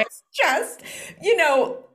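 high squeaky laugh through the words. Real, not acted. Mom laughs at herself telling the host. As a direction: laugh it out, half giggling. Laughing while confessing to snapping.

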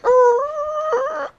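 very high, loud shocked shriek. Retold or imitated. Vlogger reacts to the kid's fact with a high shriek. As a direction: shriek in disbelief. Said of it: "and I was like".

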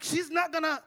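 high-pitched, exasperated protest to himself; really upset. Retold or imitated. Preacher sees she won't make it easy, upset. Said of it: "I'm getting really upset now".

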